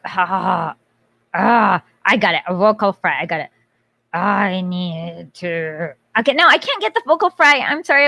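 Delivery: ordinary delivery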